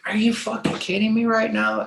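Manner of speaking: high, disbelieving protest; can't believe what the cops are saying; incredulous outrage. Retold or imitated. Cops threaten him over minor.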